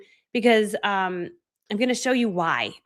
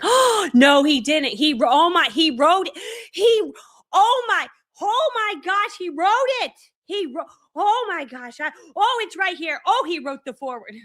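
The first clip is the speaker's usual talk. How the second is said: shocked excitement; 8 semitones higher than usual. Retold or imitated. Reliving reading Russell Brunson's foreword in Starbucks, ecstatic. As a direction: squeal like you just can't believe it. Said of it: "I'm not quiet in this mo-, like, I lose, like, human function".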